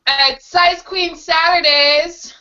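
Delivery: slow, drawn-out host intro; show announcer. Acted. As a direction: announce it big, like a showman.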